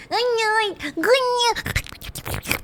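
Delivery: very high stretched good night; high sing-song good night. Pretend.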